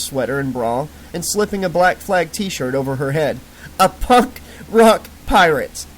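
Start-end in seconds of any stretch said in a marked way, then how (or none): 3.8-5.9 playful. Acted. Playing along with drunk girl.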